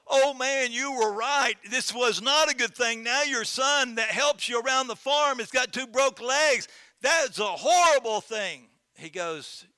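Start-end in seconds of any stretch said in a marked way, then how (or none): 0.1-8.7 high, loud, lamenting neighbors; dismayed neighbors. Acted. Neighbors lamenting to the farmer about his son's broken legs, high and loud. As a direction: wail over the broken legs.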